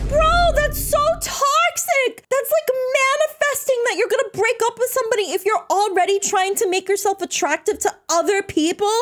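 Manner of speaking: put-on high, sweet anime-girl voice; squeaky cute streamer pleading.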